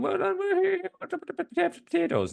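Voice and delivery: high, put-on Irish accent; mock Irish brewers cheering, pitched high. Podcast host mocks Irish brewers, high Irish accent. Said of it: "Irish breweries going".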